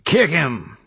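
high, urgent command; called order. A narrator's reading. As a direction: call out mid-fight, pitch jumping up. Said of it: "he called".

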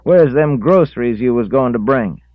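high, loud scolding woman; angry scolding. A narrator's reading. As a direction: demand it angrily.

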